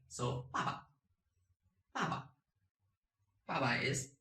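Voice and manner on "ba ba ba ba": "ba ba" falling, second syllable short and light; slight neutral tone. Shown as a demonstration. Teacher demonstrates neutral tone, ba ba with a slight second syllable. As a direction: stress the first "ba", barely touch the second. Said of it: "just do it slightly".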